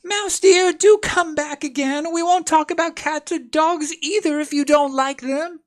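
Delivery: very high, loud coaxing call after the mouse; Alice calls after the departing mouse, coaxing and high. A narrator's reading. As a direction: call it back sweetly. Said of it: "She called softly after it".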